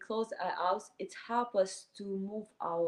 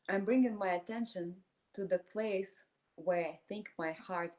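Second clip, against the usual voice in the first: calm meditation voice with long silent pauses; guided meditation. Acted. Teacher leading a group through inhale and exhale, guided meditation. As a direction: guide them breath by breath, leaving space between phrases.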